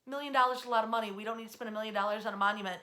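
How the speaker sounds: fast, rattled-off mock objection; protesting taxpayers. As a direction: rattle off the complaint quickly like an annoyed taxpayer. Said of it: "They're going".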